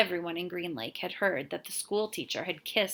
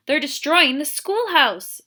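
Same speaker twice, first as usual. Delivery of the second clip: gasping for breath; 8 semitones higher, 11 dB louder than usual. A narrator's reading. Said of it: "gasping for breath".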